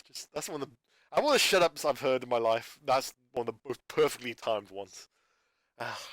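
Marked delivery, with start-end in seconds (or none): none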